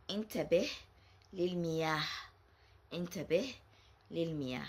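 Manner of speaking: "Intabih lil miyah" with the final h kept light; sukoon on ha. Shown as a demonstration. Teacher showing the ha with sukoon. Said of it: "if you put a sukoon on the ha".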